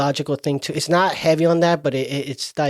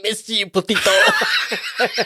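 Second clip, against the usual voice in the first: slow mock tender alien; 5 semitones higher, much slower than usual. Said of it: "He's like".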